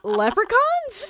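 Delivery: high, drawn-out disbelieving "Leprechauns?"; incredulous guess. Retold or imitated. Telling a friend, she guesses the word in disbelief. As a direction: drag out "Leprechauns?" in shocked disbelief.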